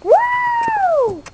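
very high, loud excited woo; a wild cheer mid-jump; whoop. Acted. Toy dog leaping off, very high loud whoop.

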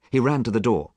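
ordinary delivery

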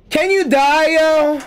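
exasperated yell. Real, not acted. Gamer yelling at an opponent who won't die, exasperated. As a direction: yell at the screen for him to die.

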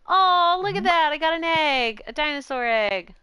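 high, loud delighted exclamation; excited discovery. Real, not acted. Streamer finding an egg in the game, excited. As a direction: burst out thrilled, starting high and loud.

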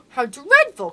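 sharp gasp, then a loud exclamation; horrified cry. A narrator's reading. Princess hearing of the goblins' marriage plot, horrified. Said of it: "cried the princess".

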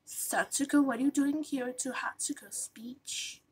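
soft whispered question. Acted. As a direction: whisper it so no one hears. Hushed question.

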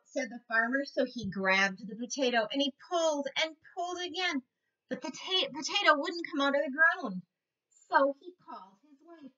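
lively storybook voice for little ones; reading to children. A narrator's reading. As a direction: read it like a teacher at story time. Reads picture book to kids.

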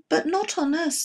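high startled little cry; alarmed oysters. A narrator's reading. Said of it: "the oysters cried".

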